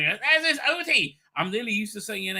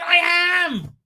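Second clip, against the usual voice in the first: proud shout; 13 semitones higher, 7 dB louder than usual. Real, not acted. Proud answer to chat.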